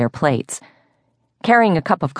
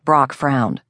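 plain reading voice

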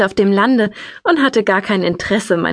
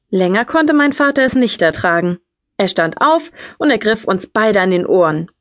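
plain reading voice

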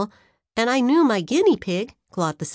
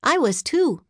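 ordinary delivery

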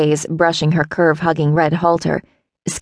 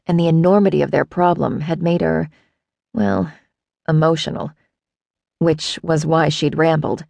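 ordinary delivery, nothing marked

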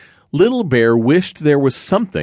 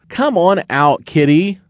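calling out; 8 semitones higher than usual. Acted. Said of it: "called Little Bear".

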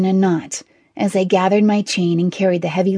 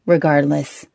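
mildly weary concession; understated resigned tone; sighing; 3 semitones lower than usual. A narrator's reading. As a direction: give in with a hint of a sigh. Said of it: "Sir Axon sighed".

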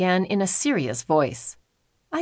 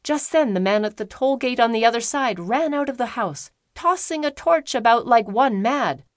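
ordinary delivery

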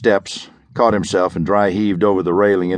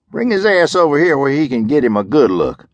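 higher, louder lazy command; sheriff ordering men; 7 semitones higher, 3 dB louder than usual. Acted. Sheriff ordering deputies to bring Parker over, raised. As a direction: order the deputies, lightly voiced lawman. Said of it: "the sheriff said".

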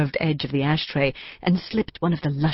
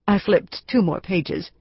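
plain reading voice

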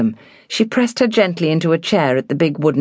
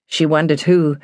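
ordinary delivery

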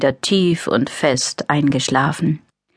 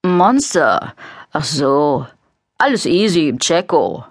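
fake deep voice. A narrator's reading. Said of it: "mit betont tiefer Stimme".

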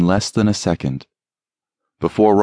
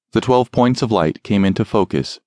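ordinary delivery

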